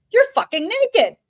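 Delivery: high-pitched mock outcry from the fable; child's blunt exclamation. Child calls out the naked emperor.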